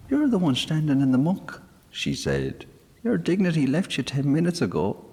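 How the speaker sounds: put-on high, fast young girl; girl sassing the muddy sprite; smart girl. A narrator's reading. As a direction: fire back quickly. Girl retorts.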